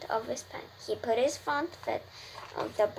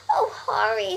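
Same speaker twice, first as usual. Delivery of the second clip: urgent plea; 8 semitones higher, 5 dB louder than usual. A narrator's reading. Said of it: "said Wilbur".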